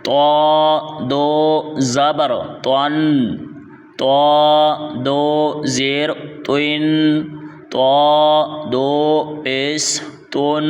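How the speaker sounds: slow drill with thick emphatic ط in تو; heavy, full letter. Shown as a demonstration. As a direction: draw out تو as a rounded "toaa", then تون، توین. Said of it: "اس کو یوں پڑھنا ہے".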